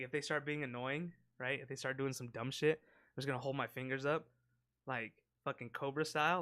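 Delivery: normal talking voice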